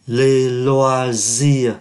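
"Les loisirs" very slow, final S silent; drawn-out model reading of "Les loisirs"; slow French demo. Shown as a demonstration. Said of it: "ký tự S cuối không có đọc". (đọc mẫu thật chậm "Les loisirs", không đọc S cuối)

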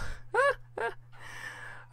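bursting into high laughter; real laugh at a funny result; amused laugh. Real, not acted. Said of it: "that's so hilarious".

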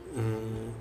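usual speaking voice

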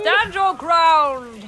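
slow, loud rallying cry; drawn-out hammed-up battle cry; mock heroic call. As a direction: bellow it like a general.

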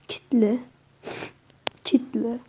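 whispered under the breath. Real, not acted. Alone at the locked door, whispering. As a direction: whisper the word to yourself.

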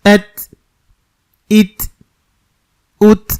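এত ইত উত ending on a stopped ত with air; sakin ta. Shown as a demonstration. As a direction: close each on a breathy ত. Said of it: "সাকিন করে আদায় যদি করি". (সাকিন ত বাতাস সহ)

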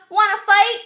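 high, loud picking a fight; cocky little bug calling out; grouchy ladybug. A narrator's reading. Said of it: "said the grouchy ladybug".

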